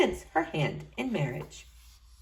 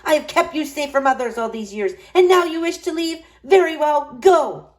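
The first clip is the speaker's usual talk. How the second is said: rising, loud furious witch; angry witch; 7 semitones higher, 6 dB louder than usual. A narrator's reading. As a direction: cast her out in anger. Witch's fury at Rapunzel.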